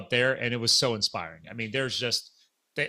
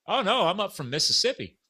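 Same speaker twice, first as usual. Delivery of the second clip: retold Southern accent, pitched higher; heavy Southern accent; 7 semitones higher than usual. Volunteer answering where he's from, Southern accent. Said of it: "heavy Southern accent".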